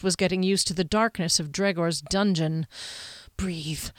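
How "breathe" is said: softer, unvoiced-sounding whisper on a breath; whispered self-calming. Chief fighting rising panic, whispering to himself. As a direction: whisper it to steady yourself.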